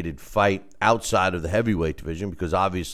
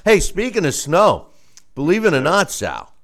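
usual speaking voice

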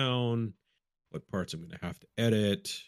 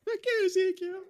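dog voice. Pretend. Dog barking across street.

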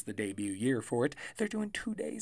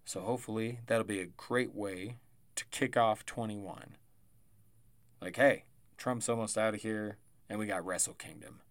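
ordinary delivery

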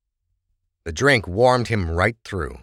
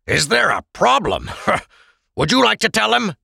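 a touch of angry mockery, raised pitch; mocking echo; 3 dB louder than usual. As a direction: throw the question back at Oral. Krebs angrily echoes guard.

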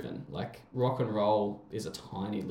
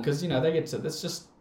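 ordinary talk, nothing marked

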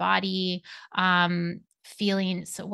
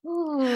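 rising ooh then long sigh. Acted. Release after channeling.

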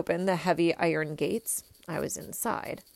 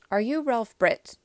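louder, higher, formal question; said loudly; 5 semitones higher, 6 dB louder than usual. A narrator's reading. Boy questions Ralph for the tape, loudly. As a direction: project it clearly for a hidden recorder. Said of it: "I said it loudly".

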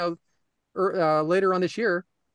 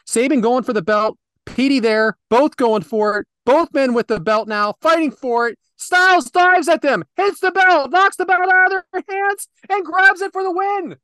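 play-by-play building from brisk to shouted, much higher and louder; live match call; 8 semitones higher, 7 dB louder than usual. Acted. Podcast host calls the match finish for his co-hosts, building to an excited shout. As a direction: call the finish like it's the biggest spot of the night.

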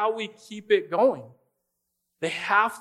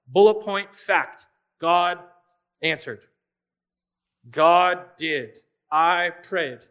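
louder, clipped list of facts, each a full stop; terse bullet points; 7 dB louder, slower than usual. Shown as a demonstration. Preacher demoing a no-emotion journal entry, loud and terse. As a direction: read it like a spreadsheet, fact by fact. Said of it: "You can be very engineer, spreadsheet, accountant-like".

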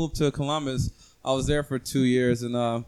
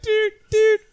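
high, louder doot doot; silly doot sounds; 5 dB louder than usual. Pretend. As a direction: toot it like a little horn. Ohio's mock sound.

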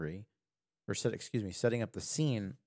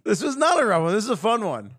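much higher, louder, bright disagreement; cheerful protest; 11 semitones higher, 11 dB louder, faster than usual. Real, not acted. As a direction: cheerfully overrule him, pitched up high.

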